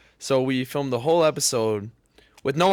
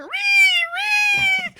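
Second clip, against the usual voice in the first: imitated high-pitched animal squeal; mole squeal; 6 dB louder than usual. Kid showing mom the dying mole's noise, mock squeal. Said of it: "it's going".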